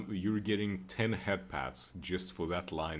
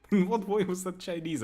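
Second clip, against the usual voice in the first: laughing disbelief; 11 semitones higher, 5 dB louder than usual. Real, not acted.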